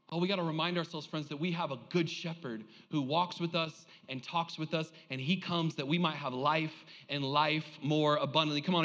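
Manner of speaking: ordinary talk, nothing marked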